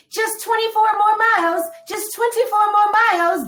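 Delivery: very high, loud child's cheer, imitated; little girl yelling. Little girl cheering runners early in race, imitated high yell. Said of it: "it's a little girl yelling".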